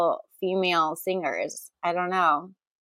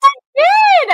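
caught out; 10 dB louder than usual. Real, not acted. Caught out, high yelp.

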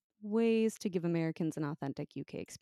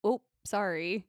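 slow, louder Canadian-style "sorry"; Canadian-sounding accent; 3 semitones higher, 6 dB louder, slower than usual. Shown as a demonstration. Host giving example to co-host, Canadian-sounding accent. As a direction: exaggerate Canadian rounded vowel in "sorry". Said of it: "like, a little bit Canadian".

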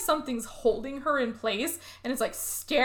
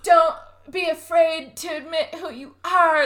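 higher, louder put-on dying voice; mock dying man; 6 semitones higher, 5 dB louder than usual. Dying guy talking to his camera, mock last words. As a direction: ham up dramatic last words. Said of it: "laying there on the ground dying".

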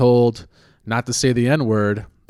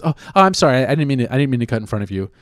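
mock apology; 2 semitones higher, 4 dB louder, much faster than usual. Imitating someone who cut in line, falsely apologetic.

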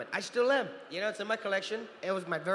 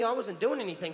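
ordinary delivery

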